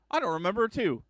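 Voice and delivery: surprised. Retold or imitated. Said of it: "'cause I was like".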